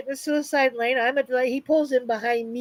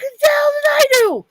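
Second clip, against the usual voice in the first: much higher, louder outraged question; indignant disbelief; 13 semitones higher, 6 dB louder than usual. Retold or imitated. Driver protesting to the cop, indignant and loud. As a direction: throw up your hands at the cop. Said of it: "I'm like".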